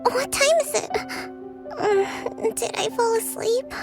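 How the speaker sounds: high, groggy startled questions; just-woken confusion. Acted. Leader waking before the feast, startled and confused.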